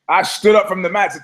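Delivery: ordinary talk, nothing marked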